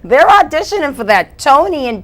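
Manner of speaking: high, very loud mock exclamation; excitable Southie mother. Mother spots an audition for her daughter, loud high excited imitation. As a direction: imitate an excited mom, shrill and drawn out. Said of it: "she's like a leprechaun from Southie".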